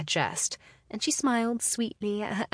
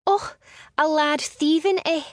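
mocking, much higher and louder echo; scoffing echo; 10 semitones higher, 6 dB louder than usual. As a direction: throw his words back at him with scorn. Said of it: "Eliana scoffed".

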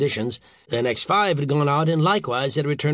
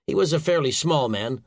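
ordinary delivery

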